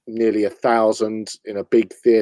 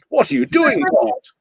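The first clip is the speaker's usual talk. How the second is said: Bond sidekick; 4 semitones higher, 3 dB louder than usual. Acted. Second figure in Bond skit.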